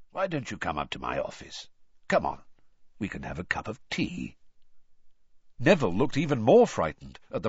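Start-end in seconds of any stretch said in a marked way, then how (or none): 0.1-4.4 low, soft gruff invitation; gentle old growler, voice dropped. A narrator's reading. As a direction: coax kindly in a low growl. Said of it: "much lower and gentler growl".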